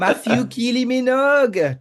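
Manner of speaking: "Matthew Kylie Minogue" said high and loud as kee-lee mee-nog; French-style surname. Pretend. Joking to her co-host about his surname, French-style "[name]". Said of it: "sounds like my surname when you say it like that".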